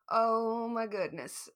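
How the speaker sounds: ordinary talk, nothing marked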